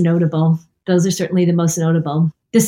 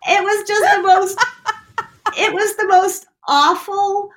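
laughing; 12 semitones higher than usual. Real, not acted. Laughing at her own story.

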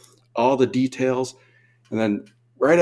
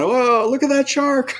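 high, louder excited whoa; excited shaky-cam diver; 4 dB louder than usual. Retold or imitated. Novice diver filming sharks, high excited gush. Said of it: "Handheld like this going".

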